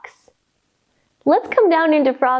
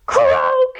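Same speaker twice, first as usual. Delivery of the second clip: high, long croak; a playful croak tossed up high; frog croak; 11 semitones higher than usual. Pretend. Reader leaping like a frog with kids, high croak. Leaping up after counting from ten.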